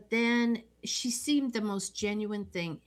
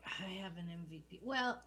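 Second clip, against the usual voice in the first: ordinary talk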